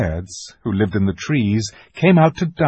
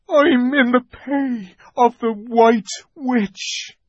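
high, slow heavy confession; a guilty creature admitting his master; confessing fawn; 14 semitones higher, 4 dB louder, slower than usual. Acted. The fawn admits to Lucy he serves the Witch, guilty.

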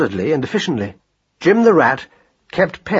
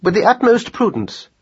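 high, precise pronouncement; formal observation, pitched well up; pedantic scholar mouse; 9 semitones higher than usual. A narrator's reading. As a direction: announce it like a learned little mouse. Voices Marcus Aurelius.

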